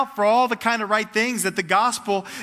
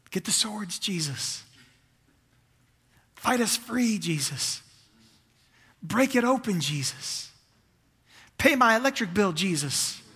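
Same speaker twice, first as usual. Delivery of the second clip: imitated crowd pleas; 3 dB quieter than usual. People hoping Jesus will overthrow Rome, calling out pleas. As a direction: call each demand, then fall away softly. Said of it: "They're crying this".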